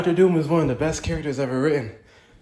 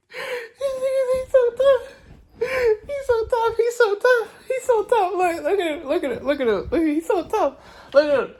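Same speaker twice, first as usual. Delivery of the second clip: much higher, crying-laughing squeal of awe; fangirl squeal. Real, not acted. Fan squealing at the trailer villain, tearful excited squeal. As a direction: squeal with delight, near tears.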